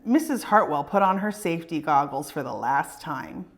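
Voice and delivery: ordinary delivery, nothing marked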